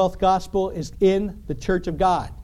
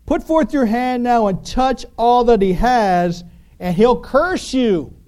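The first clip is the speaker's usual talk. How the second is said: higher, louder, slow taunting Satan; Satan's dare; 6 semitones higher, 5 dB louder, slower than usual. Retold or imitated. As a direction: dare God, slow and pointed. Satan voiced daring God.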